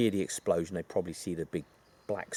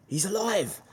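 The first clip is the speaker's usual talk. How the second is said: surprised; 12 semitones higher than usual. Retold or imitated. Duck revealed alive at end.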